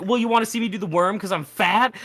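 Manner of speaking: retold indignant challenge. Offended bar guy confronting the friend, mock indignant. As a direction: imitate a guy taking it personally. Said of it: "one guy took it personally".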